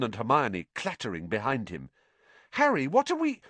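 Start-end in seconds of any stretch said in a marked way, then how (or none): 2.5-3.4 put-on puzzled girl voice. A narrator's reading. Confused friend interrupted.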